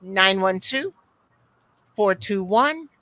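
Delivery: normal talking voice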